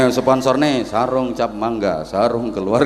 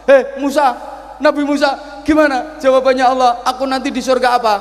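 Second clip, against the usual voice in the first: high, loud, hurried demand; impatient old man; 9 dB louder, faster than usual. Retold or imitated. Old man impatiently demanding Musa's answer, loud and hurried. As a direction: press him for an answer, fast and loud. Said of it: "nggak sabar".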